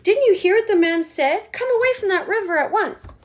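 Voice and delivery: high, loud scolding; scolding mother. Acted. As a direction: tell him off from a distance. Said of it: "called out Missus Gloop".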